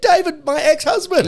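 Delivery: high, loud mock outburst; imitated exasperation. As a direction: shout the clarification in mock exasperation. Acts out exasperated ex-wife explaining.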